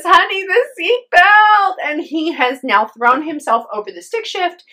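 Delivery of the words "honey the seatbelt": high, loud and slow, stuck and scared; frightened plea. Retold or imitated. Wife stuck in her seatbelt calling to husband, frightened. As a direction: drag it out in alarm at the jammed belt. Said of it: "And she says".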